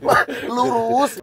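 high, drawn-out mock NTT accent; mixed NTT accent. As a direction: stretch the directions in an imitated NTT-Javanese accent. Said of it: "N T T di Pulau Logat jadi N T T". (tiruan logat NTT campur Jawa, melengking)

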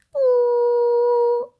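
high, chirpy toy doll voice; squeaky doll voice. Acted. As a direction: play a cheeky little doll. Doll shuts off.

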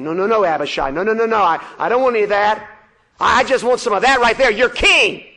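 loud, high, mocking refusal; sneering giant. The giant sneering at Abishai, loud and mocking. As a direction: wave him off, then point at the king.

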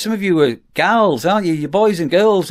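ordinary talk, nothing marked

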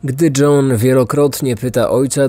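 usual speaking voice

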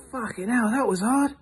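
high, strained voice; acted pain. Kicked man recovering in front of farmer, high pained strain. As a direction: squeak it out after a blow to the groin.

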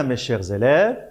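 usual speaking voice

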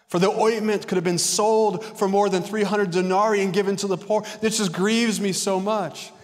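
loud, high, self-pitying lament; hammed-up wail that begs for the room's sympathy; acted grief. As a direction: play the aggrieved victim, pitched high and projected. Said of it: "That's a narcissistic manipulation".

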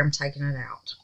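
soft, low, to herself; decide it quietly; quiet resolve. Real, not acted. Deciding to unmold anyway.

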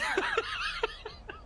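high-pitched real laughter; laughing. Real, not acted. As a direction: crack up laughing. Laughs mid-remark about Australia.

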